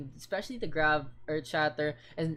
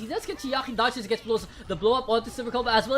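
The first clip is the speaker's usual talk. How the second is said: loud, excited esports call; hype casting; 4 semitones higher, 8 dB louder than usual. Acted. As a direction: build the hype as the team falls. Hype play-by-play.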